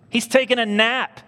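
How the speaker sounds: high, loud punchline; incredulous exclamation. Real, not acted. Preacher revealing what Jesus did during the storm, incredulous. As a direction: exclaim it in disbelief.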